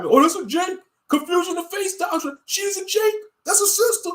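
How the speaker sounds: mocking falsetto. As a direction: squeak it like a gushing hypocrite. Mocking camp members' hypocrisy.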